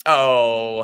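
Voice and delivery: normal talking voice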